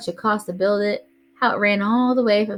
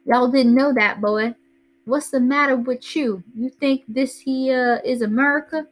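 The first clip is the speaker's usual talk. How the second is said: Southern style; 4 semitones higher than usual. Retold or imitated. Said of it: "Dad to say more Southern style stuff".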